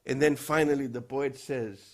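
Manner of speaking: ordinary talk, nothing marked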